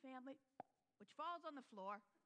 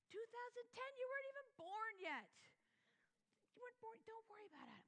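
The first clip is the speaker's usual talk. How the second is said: high, light reassurance; mother calming her son; reassuring mom; 10 semitones higher, faster than usual. Retold or imitated. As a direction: soothe the worried boy.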